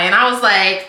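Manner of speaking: ordinary talk, nothing marked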